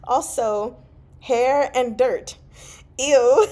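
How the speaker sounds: high and loud, laughing through the friend's words; laughing through a quote. Retold or imitated. Reading a friend's horse reply aloud, laughing. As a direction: giggle out the quote, barely holding it together.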